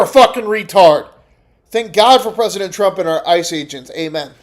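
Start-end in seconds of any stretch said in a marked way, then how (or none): none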